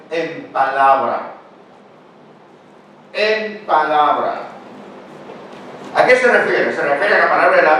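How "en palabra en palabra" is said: slow emphasis. Shown as a demonstration. Preacher stresses the key phrase.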